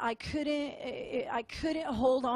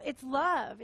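ordinary talk, nothing marked

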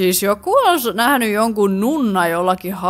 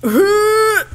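sharp high gasp of fright; a quick high gasp, caught off guard; startled gasp; 10 semitones higher, 5 dB louder than usual. Real, not acted. Player startled by something on screen, high gasp.

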